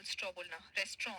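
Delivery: "रेस्ट्रॉ" with a nasalised "straw" at the end; slightly nasal ending. Shown as a demonstration. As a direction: send the last vowel of "रेस्ट्रॉ" through the nose. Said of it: "थोड़ा नाक के बल". ("रेस्ट्रॉ" का अंत थोड़ा नाक से)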